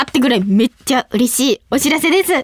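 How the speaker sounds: usual speaking voice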